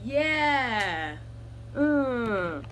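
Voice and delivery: soft, slow, put-on mocking tone. Mocking people who think she'll dress like a guy, hushed sarcasm.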